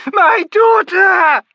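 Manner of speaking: mother's anguish peaking in a shout; full shriek. A narrator's reading. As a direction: let it break into a full scream. Myby in anguished terror.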